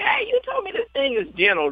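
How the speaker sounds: high-pitched retold complaint; indignant protest. Rider hollering at laughing jockeys from the gate, mock-indignant.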